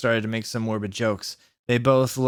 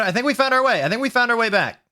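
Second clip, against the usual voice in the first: very high, giddy announcement; excited relief; 10 semitones higher, 4 dB louder, faster than usual. Real, not acted. Reader finding her place in the story, excited. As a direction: squeal it in a high pitch.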